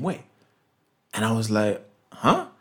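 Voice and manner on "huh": baffled huh. Retold or imitated. Confused reply repeated.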